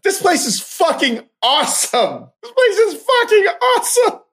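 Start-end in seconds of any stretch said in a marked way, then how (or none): 2.4-4.2 high-pitched excited shout of praise. Real, not acted. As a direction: blurt it out like a thrilled fan. Repeats it with more force.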